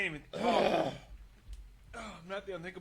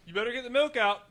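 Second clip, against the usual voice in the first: mocking quote; 5 semitones higher, 12 dB louder than usual. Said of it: "the people that are always like".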